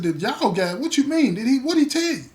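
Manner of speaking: high, loud burst of questions; acted alarm, pitch jumping up; startled questioning. Storyteller replays grilling the man who confessed, startled.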